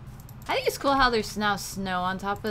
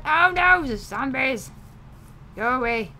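alarmed shout; 2 semitones higher, 7 dB louder than usual. Real, not acted. Zombies appear.

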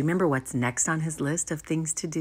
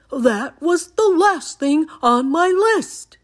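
high, loud excited cry; shout; 10 semitones higher, 7 dB louder than usual. A narrator's reading. Toad remembering the last item, shouting. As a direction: exclaim it loudly, high-pitched. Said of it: "shouted Toad".

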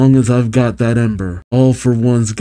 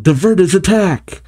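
higher-pitched urgent call; 7 semitones higher than usual. Acted. Burning to fellow heroes in battle, calling out. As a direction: call out the order, pitch lifted. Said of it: "Burning calls out".

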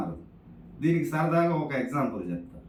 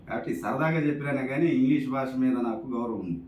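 normal talking voice

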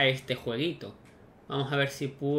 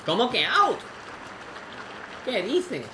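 indignant disbelief; 8 semitones higher than usual. Real, not acted. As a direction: protest the call in shock. Disputing a line call.

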